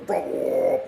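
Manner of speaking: mock engine roar, lightly voiced. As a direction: growl it like a plane engine revving. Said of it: "like that".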